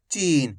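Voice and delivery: चीन with a long, stretched ee; drawn-out vowel. Shown as a demonstration. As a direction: stretch the ee in चीन, letting it fall. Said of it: "खींच के पढ़ेंगे".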